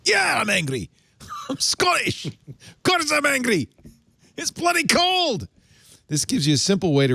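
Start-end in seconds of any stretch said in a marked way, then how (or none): 0.1-5.5 put-on high-pitched, cranky Scottish accent; angry Scot in a high, drawn-out accent. Host showing his Scottish accent sounds cranky, high angry Scottish accent. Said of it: "if I do my Scottish accent a lot".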